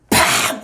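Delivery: sudden loud burst of "팍"; explosive slam sound effect; imitated door slam. Telling congregation of someone slamming a door, explosive bang.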